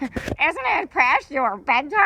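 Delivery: high, slow teasing bird voice; teasing parrot. Acted. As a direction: tease like a cheeky parrot. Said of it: "Papagayo laughed".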